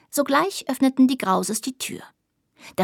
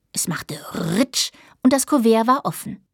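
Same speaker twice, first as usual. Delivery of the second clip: ordinary delivery, nothing marked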